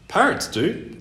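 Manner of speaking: high, curt bird reply; snippy old bird; grumpy bird voice. Acted.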